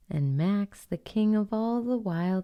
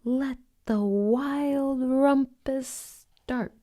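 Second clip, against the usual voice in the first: high, slow gleeful proclamation; joyful royal shout; gleeful cry; 7 semitones higher, slower than usual. Acted. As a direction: proclaim it like a wild king. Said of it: "cried Max".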